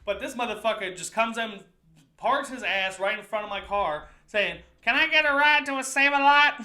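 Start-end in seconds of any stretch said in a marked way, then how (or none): 4.8-6.6 high, loud mock begging voice; pushy stranger. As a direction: holler it like a pestering panhandler. Repeats the stranger's demand.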